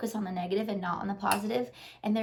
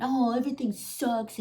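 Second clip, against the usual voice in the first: lightly voiced grumpy whiner; a hint of a grumpy person griping; grumpy complainer; 3 semitones higher than usual. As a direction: put on a mild sulky moan. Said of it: "if you choose to be grumpy".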